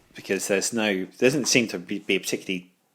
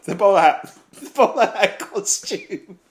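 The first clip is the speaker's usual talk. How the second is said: high, loud, laughing through the words; helpless laughter; 11 semitones higher, 5 dB louder than usual. Real, not acted. Podcaster cracks up at the costume idea, laughing hard. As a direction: squeal it out while cracking up.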